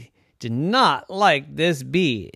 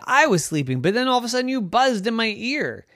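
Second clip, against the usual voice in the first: high, fast cat complaint; 11 semitones higher, 3 dB louder, faster than usual. Acted. Cat Purdy blaming the bee, high and fast. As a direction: blame the bee, rushing. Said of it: "And Purdy said".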